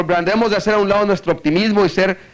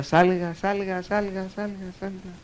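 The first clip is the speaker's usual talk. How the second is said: imitated echo; 3 semitones higher, 8 dB quieter than usual. Cave throwing the man's word back, fading echo. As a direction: echo dying away to nearly nothing. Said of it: "Y se oía".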